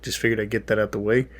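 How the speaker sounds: usual speaking voice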